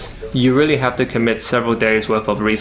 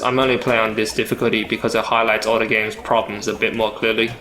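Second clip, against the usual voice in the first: normal talking voice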